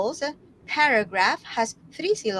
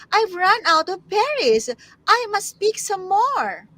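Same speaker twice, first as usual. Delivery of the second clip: higher-pitched, animated storybook character; crying out as Bear; 9 semitones higher, 5 dB louder than usual. A narrator's reading. Tutor voices Bear needing more berries, animated dismay. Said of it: "cried Bear".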